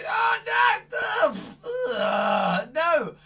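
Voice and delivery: high shouted ugh and no; mock cries of distress. Magician to kids, hammed-up yelping protest. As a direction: ham up being tickled.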